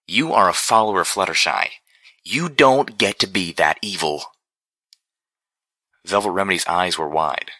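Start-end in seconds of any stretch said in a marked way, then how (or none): 0.1-4.3 barked rebuke. A narrator's reading. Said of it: "I barked".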